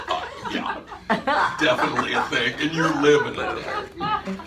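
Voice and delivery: imitating a bored, eye-rolling teen. He mimics his daughter rolling her eyes, sarcastic. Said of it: "I can hear her rolling her eyes".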